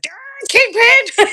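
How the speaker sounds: high, slow excited cry. Retold or imitated. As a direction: squeal it like a thrilled fan. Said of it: "losing my mind".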